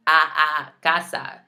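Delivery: "A, a" as open "ah", then "casa" with the same vowels; open Spanish A. Shown as a demonstration. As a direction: model the "ah" sound in "casa". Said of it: "how I'm saying those A's".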